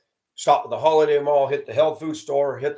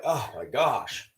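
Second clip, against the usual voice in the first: disgusted oh my gosh; 6 dB quieter, slower than usual. Retold or imitated. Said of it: "I'm just like".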